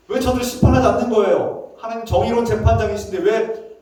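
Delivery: high, loud indictment; accusing protest. Acted. Prophet accuses the righteous Judge of neglect, indignant. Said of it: "막 이런 하나님을 고발하는 거 아닙니까".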